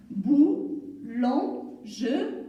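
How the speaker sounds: "boulangerie" very slowly, split into four syllables. Shown as a demonstration. Teacher splitting a word for learners, "boulangerie" syllable by syllable. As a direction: stretch and separate each syllable of "boulangerie". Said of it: "On l'a découpé en syllabes".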